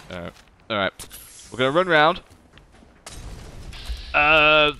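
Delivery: ordinary talk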